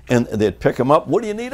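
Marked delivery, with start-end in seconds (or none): 1.1-1.5 mock concerned crew. Crew asking fallen Bobby.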